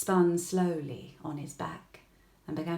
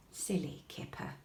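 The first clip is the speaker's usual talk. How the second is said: whispered aside; 4 semitones lower, 8 dB quieter than usual. A narrator's reading. Narrator's fond aside.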